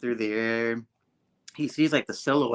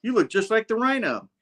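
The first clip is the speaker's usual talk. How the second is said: teasing hero; 10 semitones higher, 4 dB louder than usual. Retold or imitated. Hero teasing in comic retelling.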